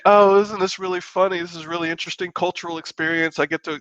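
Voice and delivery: high, breezy, delighted tourist voice; mock carefree tourist. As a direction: chirp it like a sightseer having fun. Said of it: "And not like".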